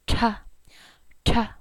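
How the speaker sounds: ta with a strong puff of air; aspirated ta. Shown as a demonstration. Teacher demonstrating the breathy ta. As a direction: blow air out with the ta. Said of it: "blow out while you say that letter".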